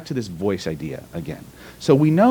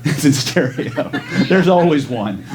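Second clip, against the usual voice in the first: amused, higher-pitched, through real laughter; laughing; 5 semitones higher, slower than usual. Real, not acted. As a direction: say it while cracking up. Laughing at a participant's response.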